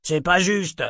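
high-pitched yelled protest; aggrieved outburst; crying out. A narrator's reading. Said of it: "cria Arthur".

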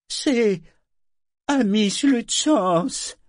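high, slow, wary announcement; defensive. A narrator's reading. Woman names herself to the ship, high and defensive. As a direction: give the name guardedly, pitched high. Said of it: "répondit-elle sur la défensive".

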